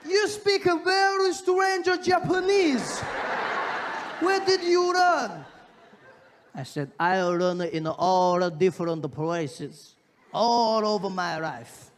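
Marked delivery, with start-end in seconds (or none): 0.0-5.5 very high, loud mock Japanese accent; baffled Japanese man, slowly. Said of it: "He was like". 7.0-11.9 put-on Japanese accent. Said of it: "speak English with a Japanese accent".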